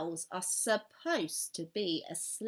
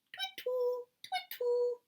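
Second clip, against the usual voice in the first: much higher, slow hooting twittle; owl call; slower than usual. Acted. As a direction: sing the owl call up high. Girl imitates an owl call again.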